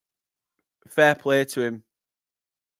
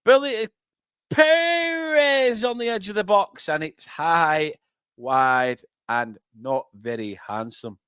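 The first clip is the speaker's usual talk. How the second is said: soar on the name, then deflate; excited shot call deflating; 3 semitones higher, slower than usual. Real, not acted. Commentator watches Perez's shot go high and wide, excitement deflating.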